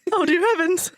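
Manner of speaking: a scandalized society lady; mock posh shock. Regency mock exclamation.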